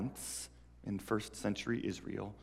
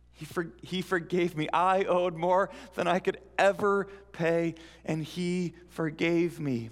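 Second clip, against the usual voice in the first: breathless good news; 5 semitones higher than usual. Acted.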